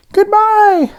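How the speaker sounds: very high, bright little donut calling out goodbye; squeaky cheerful farewell shout; high donut voice yelling. A narrator's reading. Donut calls goodbye to departing donuts, high and loud. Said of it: "Arnie yelled at each donut".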